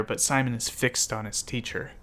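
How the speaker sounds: usual speaking voice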